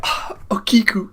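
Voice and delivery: high, slow breathless whisper of awe; awed whisper. Retold or imitated. Viewer awed by Okiku's sword move, high breathless whisper. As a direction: whisper her name in amazement. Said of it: "I was like".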